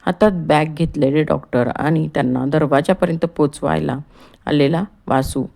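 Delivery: usual speaking voice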